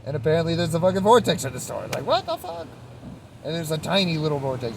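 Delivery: high, loud disbelieving outburst; incredulous. Real, not acted. Telling viewers about a vortex in a store, high incredulous outburst. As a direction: squeal out the shock.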